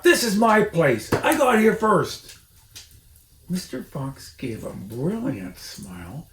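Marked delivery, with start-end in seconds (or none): 0.0-2.4 high, loud squeaky shriek; shrieking Rat. A narrator's reading. Rat shrieks at Mister Fox to leave. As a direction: screech like an outraged rat. Rat defending his spot.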